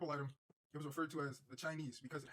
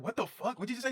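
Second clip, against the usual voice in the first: higher and louder, stunned disbelief; shocked outburst; 8 semitones higher, 7 dB louder than usual. Retold or imitated. Hearing a stoned friend beg for help, shocked loud outburst. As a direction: blurt it out, startled he asked for help. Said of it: "I was like".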